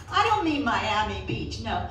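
ordinary talk, nothing marked

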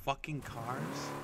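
slow, whispered mutter under the breath; mumbled aside. Real, not acted. Gamer mutters to himself over his car collection, slow whisper.

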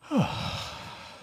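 big incredulous sigh; doubtful sigh. Pretend. Congregant reacting to the call to miracles, doubtful sigh. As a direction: let out a heavy, doubting breath.

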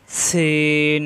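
সিন held long with a hissing s; slow model সিন; Arabic letter sin. Shown as a demonstration. Teacher repeats সিন for students, drawn out. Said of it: "বাতাস বের হবে".

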